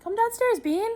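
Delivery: bright high sing-song call; customer service voice. Real, not acted. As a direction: call the dog like a service rep. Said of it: "Your voice changes so much when you're on video".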